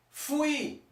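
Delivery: fui said high and drawn out, teacher modelling it; clear Spanish fui. Shown as a demonstration. Teacher to class, spelling out the verb: fui modelled high and clear.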